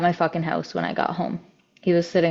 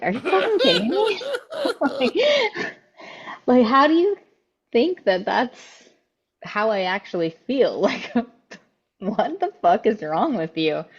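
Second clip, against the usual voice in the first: high-pitched, laughing disbelief; retold incredulity; 6 semitones higher than usual. Woman retelling her reaction to his love claim, incredulous. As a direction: squeak it in disbelief, breaking into laughter.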